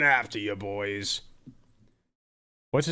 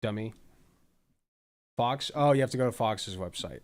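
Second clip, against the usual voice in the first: ordinary talk, nothing marked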